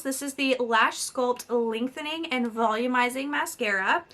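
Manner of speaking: ordinary delivery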